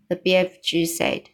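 ordinary delivery

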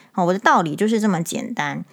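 ordinary talk

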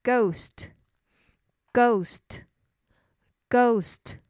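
ghost, ghost, ghost said very slowly; 慢慢重复 ghost; slow word drill. Shown as a demonstration. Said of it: "英文叫做".

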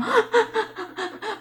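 a sudden high giggle that climbs; high rising giggle. Real, not acted. Host giggling at her own fart joke, high rising laugh. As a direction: let a bright laugh burst out and rise. Amused by farting pet joke.

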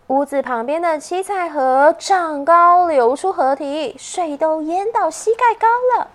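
plain reading voice

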